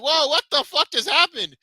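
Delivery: high, loud stunned whoa; shocked disbelief. Retold or imitated. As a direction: blurt it out in shock. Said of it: "I remember I'm like".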